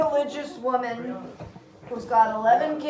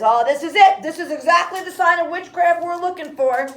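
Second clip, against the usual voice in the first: loud, high excited accusation; hammed-up triumphant exclamation; Putnam; 6 semitones higher, 9 dB louder than usual. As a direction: seize on the sign, loud and pitched up. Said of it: "Putnam points and says".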